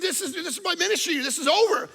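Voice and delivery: retold panic. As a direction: panic that your career is ruined. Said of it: "And I said".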